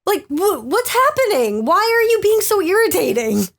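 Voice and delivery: high-pitched, drawn-out exasperation; exasperated disbelief. Retold or imitated. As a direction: act out being utterly baffled and irritated. Acting out exasperation at the accent.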